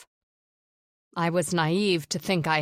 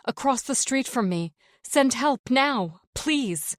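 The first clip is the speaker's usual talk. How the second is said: higher, urgent, begging for help; desperate pleading; 7 semitones higher than usual. A narrator's reading. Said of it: "I say desperately".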